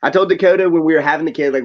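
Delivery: ordinary talk, nothing marked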